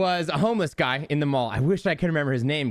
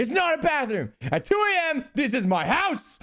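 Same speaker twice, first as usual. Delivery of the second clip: high-pitched, indignant mock scream; imitated screaming; 9 semitones higher than usual. Homeless guy to bathroom intruders at two A M, imitated screaming. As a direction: scream high, as if defending your home. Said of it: "this homeless guy is just screaming".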